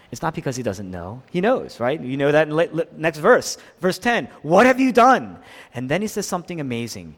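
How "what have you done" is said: loud, high, pained demand; appalled, forceful questioning; confronting question. Acted. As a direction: confront him with raised voice. God confronts Cain after the murder.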